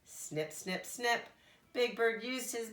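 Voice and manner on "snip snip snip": ordinary delivery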